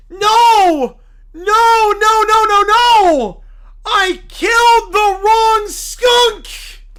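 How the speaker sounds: loud, rising shout of horror; a zealot realizing his terrible mistake; panicked cult leader. Acted. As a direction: scream it like you just ruined everything. Character panic.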